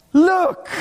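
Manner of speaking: high, emphatic cry; excited exclamation. Acted. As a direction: call everyone's attention urgently. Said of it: "And I'm going to say".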